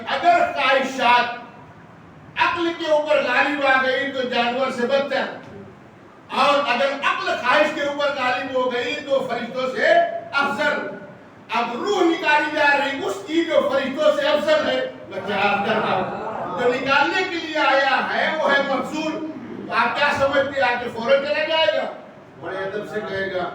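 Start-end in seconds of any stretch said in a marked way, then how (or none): 0.1-14.9 loud, high, emphatic sermon delivery; full-force preaching. Real, not acted. Preacher stating the rule to his congregation, full-force preaching. 16.6-21.9 loud, high, emphatic sermon delivery; full-force preaching. Real, not acted. Preacher stating the rule to his congregation, full-force preaching.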